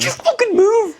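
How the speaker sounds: very high, strained comic command; mock frantic urging. Friend plays passenger urging Ella to drive, mock frantic. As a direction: urge it in squeaky mock exasperation.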